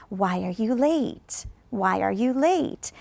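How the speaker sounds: slow, "late" falling at the end; falling tune. Shown as a demonstration. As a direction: let "late" drop down, slowly. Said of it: "That one is going down".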